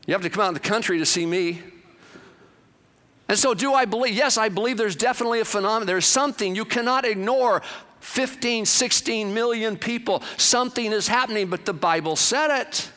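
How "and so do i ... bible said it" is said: raised, emphatic preaching; preacher rising to full force. Acted. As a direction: build the point up high. Preacher building to a peak.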